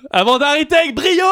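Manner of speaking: high, loud triumphant punchline. Acted. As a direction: belt it like a victory.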